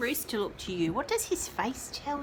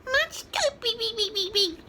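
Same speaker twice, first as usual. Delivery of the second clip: high clucky hen voice; clucking chicken; 12 semitones higher than usual. Acted. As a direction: cluck it over and over. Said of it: "clucked the younger chicken".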